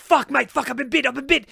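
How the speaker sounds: high, loud panicked cry; just bitten by a cobra, shouting; pained yelling. Retold or imitated. Host reacting to a cobra bite, alarmed yell.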